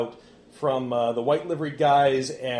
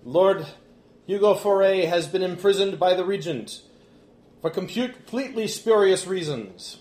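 higher-pitched declamatory public speech; Remy, indignant orator; 6 semitones higher, 6 dB louder than usual. Acted. Remy tells the guests of an unjust imprisonment, indignant orator. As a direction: proclaim an injustice to a whole hall.

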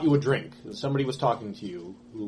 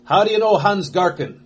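louder, higher pointed question; a hint of a suspicious guardsman; stern guardsman; 9 semitones higher, 11 dB louder than usual. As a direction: press him like a guard. Guardsman keeps interrogating.

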